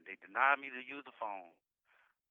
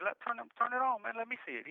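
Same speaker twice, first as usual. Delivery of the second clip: frantic plea; 10 semitones higher than usual. Retold or imitated. Begging to see the news.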